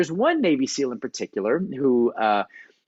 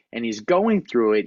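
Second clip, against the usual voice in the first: normal talking voice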